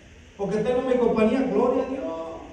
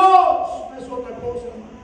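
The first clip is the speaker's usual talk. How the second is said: louder, stressed "No es otra cosa"; emphatic; 5 dB louder than usual. Acted. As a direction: hit "No" hard, then drop on "hermano". First take, emphatic. (enfático, fuerte en "No")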